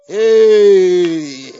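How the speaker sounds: long, loud drawn-out hey; loud preacher cry. Real, not acted. Preacher crying out to congregation, long loud hey. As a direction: cry out at full force.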